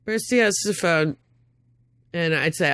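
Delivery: ordinary talk, nothing marked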